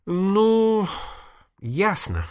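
high, drawn-out "well", then dropping; mildly resigned reproach; sighed reproach. A narrator's reading. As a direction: stretch the first word, then let it fall with a sigh. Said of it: "вздохнула Надя и упрекнула".

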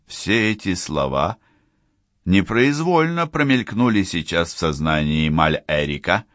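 usual speaking voice

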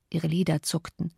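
plain reading voice

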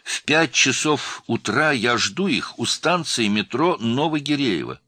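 high, loud, measured instruction; urgent. A narrator's reading. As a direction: spell out the plan insistently. Urgent meeting instructions by phone.

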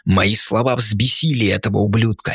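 ordinary delivery

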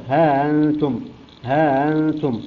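ها أنتم, the glottal stop softened to a glide; long ها sliding into أنتم without a hard catch; eased hamza. Shown as a demonstration. Teacher demonstrating Qalun's tasheel, ها أنتم with eased hamza. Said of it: "وهمزة مسهلة".

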